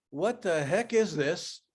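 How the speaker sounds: ordinary talk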